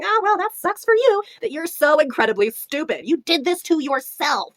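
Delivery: slow, drawn-out mock sympathy; mock scolding. Reviewer tells off a dead character, mock scolding. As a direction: start high and loud, dragging out the scolding.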